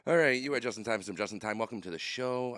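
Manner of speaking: loud upbeat show opener; show host intro. Acted. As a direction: welcome everyone like a host. Show opening announcer.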